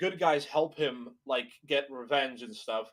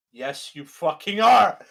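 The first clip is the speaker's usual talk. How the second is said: louder, slower, every word hit; emphatic retort; 2 semitones higher, 11 dB louder than usual. Retold or imitated. Answering the killer's denial again, slow loud retort. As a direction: lose patience and hammer it home. Acted quarrel, escalated reply.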